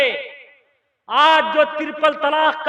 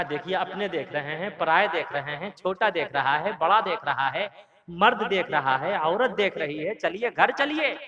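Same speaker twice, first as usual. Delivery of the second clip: much lower, softer, rising pleas; soft pleading; 9 semitones lower, 9 dB quieter than usual. Retold or imitated. Wife to drunk husband, soft lower pleading. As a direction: plead quietly, each line lifting at the end.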